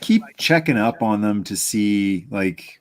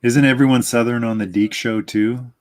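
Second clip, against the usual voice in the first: ordinary talk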